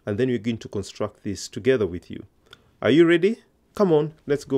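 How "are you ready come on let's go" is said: upbeat teacher. Real, not acted. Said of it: "teacher rallying students".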